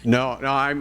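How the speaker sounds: ordinary delivery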